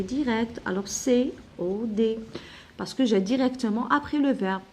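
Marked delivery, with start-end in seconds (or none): none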